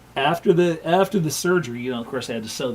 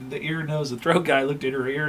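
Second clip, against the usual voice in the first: ordinary talk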